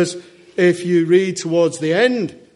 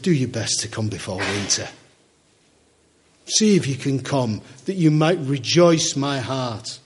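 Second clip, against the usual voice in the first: tender plea; 5 semitones lower than usual. Acted. Dying Paul pleads with Timothy.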